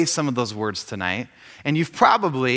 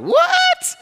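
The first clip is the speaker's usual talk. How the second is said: high, loud shriek of disbelief; astonished squeal; 6 dB louder than usual. Retold or imitated. Fan learns his favorite author speaks nearby tonight, astonished high squeal. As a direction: squeal it in amazed delight. Reacting to surprise news, retold.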